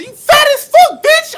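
imitating mom, high and loud screaming insult; mom's furious yell. Mom yelling at the lady in the store, imitated furious scream. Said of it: "My mom said".

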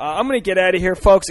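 fast, loud goodbye; loud sign-off. Real, not acted. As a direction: call out the goodbye.

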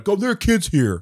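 high, loud rebuke; scolding team. Retold or imitated. Team scolding the swearing dad, loud high. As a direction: call them out for the kids. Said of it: "the team's like".

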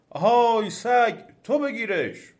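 loud, high, drawn-out order; demon calling out. A narrator's reading. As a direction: holler the command from afar. Demon shouts orders to dog.

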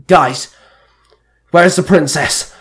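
loud, urgent question; barked. A narrator's reading. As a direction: call sharply to the group. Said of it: "Merrick barked".

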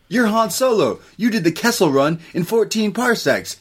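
high, eager fanboy voice; starstruck fan. Retold or imitated. New characters meeting Han Solo, high excited gush. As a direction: rattle off his legend in awe.

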